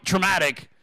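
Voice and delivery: ordinary talk, nothing marked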